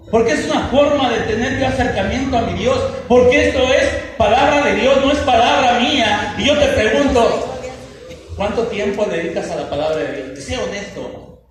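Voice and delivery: loud, driving sermon delivery; fiery preaching. Real, not acted. Pastor rebukes the flock for neglecting the Bible, fiery preaching. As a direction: preach it hard to the congregation.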